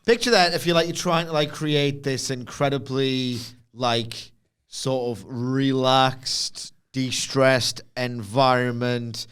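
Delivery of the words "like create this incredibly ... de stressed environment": slow, lower, relaxed delivery; slow right down into spa calm; soothing calm. Acted. Setting a calm massage scene.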